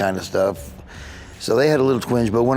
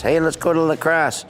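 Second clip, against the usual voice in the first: a light Wisconsin lilt, pitched higher; tossing off a Wisconsin cousin's line, higher; Wisconsin accent; 7 semitones higher than usual. Shown as a demonstration. Comedian shows how his Wisconsin cousins talk, Wisconsin accent. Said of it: "I'm talking like I'm from, you know, Serona, Wisconsin".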